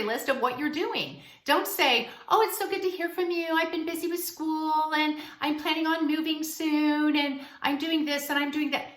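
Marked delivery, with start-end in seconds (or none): 2.3-8.9 higher, quick, bubbly rush of news; gushing overshare. Pretend. Mocking the desperate reply to the ex, gushing. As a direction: spill everything eagerly, too fast.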